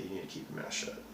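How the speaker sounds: low whispered warning; hushed threat. Retold or imitated. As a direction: warn him quietly, menacingly. Debriefers warn him to be silent.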